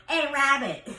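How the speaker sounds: put-on New York accent, Robert turned into "Rabbit"; Grandma Paulette. Grandson imitates his great-grandma calling him, New York accent. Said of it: "It's much more like".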